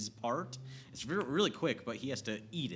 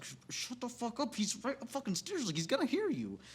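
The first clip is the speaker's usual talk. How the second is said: frantic hushed plea; 12 semitones higher than usual. Retold or imitated. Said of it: "the whole time I was like".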